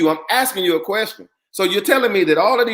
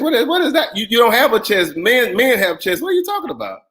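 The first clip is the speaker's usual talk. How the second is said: incredulous; 3 semitones higher than usual. Real, not acted. Host puzzled by guest's chest remark, incredulous. Host baffled by her phrase.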